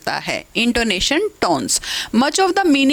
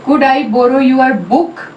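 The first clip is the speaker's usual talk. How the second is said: slow and louder, "book?" stressed and rising; 6 dB louder, much slower than usual. Shown as a demonstration. Teacher modelling a request, stress on book. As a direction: put the weight on "book?". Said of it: "बुक पे हमारा stress".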